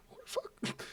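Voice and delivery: hushed, voiceless acted disbelief; bewildered whisper. Runner getting up after a fall, acted bewildered whisper. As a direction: whisper it in baffled shock.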